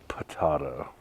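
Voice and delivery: potato said as puh-TAH-toh, low and soft; joke pronunciation. Pretend. Partner teases over the name Willys, potato said puh-TAH-toh. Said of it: "I'm gonna call it".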